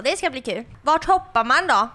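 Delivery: normal talking voice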